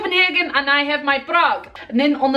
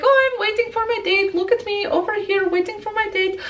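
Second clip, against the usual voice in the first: mock date waiting; 7 semitones higher than usual.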